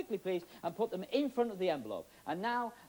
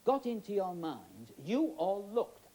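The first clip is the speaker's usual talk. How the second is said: normal talking voice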